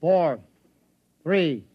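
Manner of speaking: loud, rising countdown order; command countdown. Acted. Captain counts the crew down to the jump, loud commanding call. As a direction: call each number to the whole crew.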